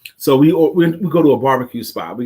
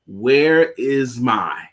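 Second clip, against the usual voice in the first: slow drawn question; much slower than usual. Retold or imitated. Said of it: "I says".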